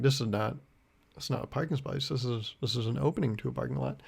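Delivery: muttered to self. Retold or imitated. Grumbling quietly at a car stopped in the entrance. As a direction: grumble at the car under your breath.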